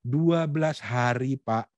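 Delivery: slow, carefully separated words; clear articulation. Shown as a demonstration. Coach models asking the director for leave, clearly articulated. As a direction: spell out each word distinctly for the boss. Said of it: "Kata-katanya jelas".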